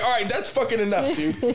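high exasperated snap; mock annoyed. Mocking a fed-up sneezer, lightly annoyed. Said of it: "you're like".

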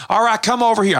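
put-on high-pitched revival preacher accent; put-on Pentecostal preacher accent. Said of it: "I don't know why I made that accent".

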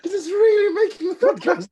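high, loud sarcastic cry through laughter; laughing shout. Real, not acted. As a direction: shout it while cracking up. Group cracks up at bad facts.

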